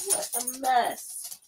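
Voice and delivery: slow, soft, high-pitched lament; slow scolding sigh over a mess; dismayed. Real, not acted. Dismayed at chewed gloves.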